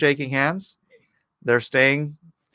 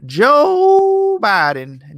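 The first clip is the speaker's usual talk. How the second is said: mock announcer; 9 semitones higher, 7 dB louder, much slower than usual. Announcing names, loud slow.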